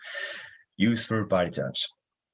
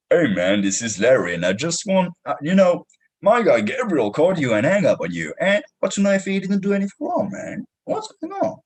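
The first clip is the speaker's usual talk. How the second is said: a lightly put-on second caller, higher and brighter at the end; Larry voice; 6 semitones higher than usual. Salesman posing as colleague Larry to a prospect, lightly voiced. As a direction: play a colleague calling back for a friend, pitch jumping up. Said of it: "Now I'm Larry".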